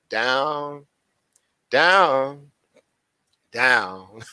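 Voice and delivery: each "down" stretched long with pauses; playful, long-held "down"; drawn-out repetition. Pretend. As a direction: let every "down" sink slowly. Answers own question, dragging it out.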